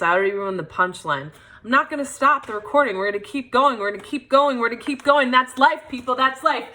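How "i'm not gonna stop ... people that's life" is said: fast, higher-pitched, repetitive push onward; insistent rallying aside. Real, not acted. Performer who ruined the punchline rallies herself and viewers, insistent aside. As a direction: rattle it off like a self pep talk. Pushes on after ruining punchline.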